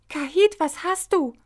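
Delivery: high, loud startled question; alarmed. A narrator's reading. Hopsi sees Kahit stagger, high and alarmed. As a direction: ask in sudden alarm. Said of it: "fragte Hopsi erschrocken".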